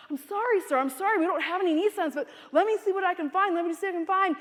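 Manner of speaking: flustered apology. Retold or imitated. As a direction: apologize over and over, scrambling to help. Flustered clerk apologizing under fire.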